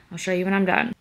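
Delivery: ordinary delivery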